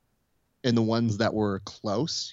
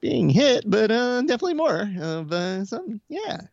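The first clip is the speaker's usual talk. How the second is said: awkward Keith; 4 dB louder than usual. Retold or imitated. Imitating Keith admitting he dislikes being hit, high and hesitant. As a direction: mimic Keith fumbling for an honest answer. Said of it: "And Keith's like".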